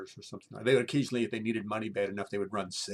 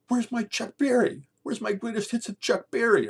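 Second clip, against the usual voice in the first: much higher, loud mock outrage; frantic yelling roommate; 12 dB louder than usual. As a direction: yell like a roommate who can't find his record. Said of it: "all of a sudden he goes".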